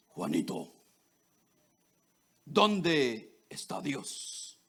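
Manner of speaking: deep, slow, solemn preacher voice. Retold or imitated. Pastor questioning the boy about God, in a deep, slow preacher voice. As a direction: boom it out like a pastor from the pulpit. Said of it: "con su voz así de predicador".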